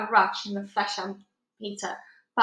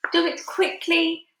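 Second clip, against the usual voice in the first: high, loud, slow panicked shout; 8 semitones higher, 5 dB louder, slower than usual. A narrator's reading. Scared giant begging the BFG to hurry, shouting. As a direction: urge him on in fright. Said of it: "shouted the flesh lump eater".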